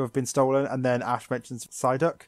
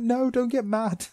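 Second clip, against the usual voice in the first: much higher, slow mock plea; pleading; 11 semitones higher, slower than usual. Host begging Misty not to get mad, high plea. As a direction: beg her gently to calm down. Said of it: "I'm like".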